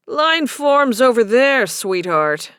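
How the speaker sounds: a hint of a brusque bouncer. A narrator's reading. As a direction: call out like a bouncer on the door. Bouncer orders her to queue.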